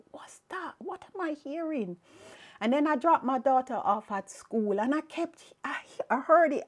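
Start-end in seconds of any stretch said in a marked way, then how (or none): 0.1-2.0 high, soft questioning; hushed puzzlement. Retold or imitated. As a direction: wonder quietly, pitch lifted. Puzzled at hearing a voice.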